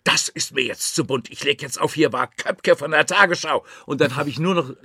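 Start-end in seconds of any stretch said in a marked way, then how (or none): 0.0-3.6 snarling caller. Retold or imitated. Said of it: "fauchte die Stimme wieder".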